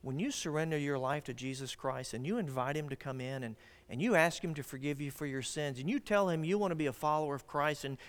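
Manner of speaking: ordinary talk, nothing marked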